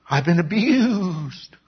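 high, very slow victim plea; hammed-up woe-is-me; mock self-pity. Acting Joseph's victim excuse.

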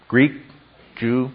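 ordinary talk, nothing marked

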